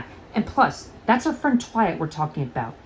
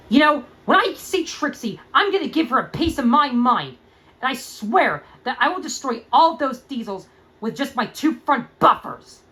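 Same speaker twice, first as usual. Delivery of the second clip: higher, rising fury; angry outburst; 6 semitones higher than usual. Acted. Starlight vents about Trixie, angry outburst. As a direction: vow revenge through clenched anger. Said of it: "I've never seen you this angry".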